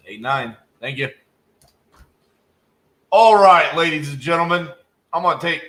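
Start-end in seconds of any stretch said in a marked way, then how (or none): 3.1-5.6 booming showman call to the room; loud announcement. Real, not acted. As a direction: announce it big to the audience. Announcing to viewers on camera.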